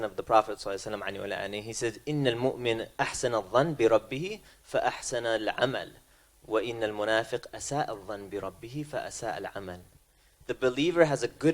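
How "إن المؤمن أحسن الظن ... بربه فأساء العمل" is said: fast quoted Arabic; rapid Arabic recitation. Real, not acted. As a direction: rattle off the Arabic saying.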